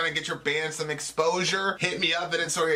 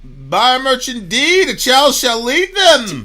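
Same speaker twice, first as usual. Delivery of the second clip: loud, high, drawn-out announcer; grand mock proclamation; 10 semitones higher, 13 dB louder, slower than usual. Host echoes the merch plug, grand proclamation. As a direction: proclaim it slowly like a mock prophet.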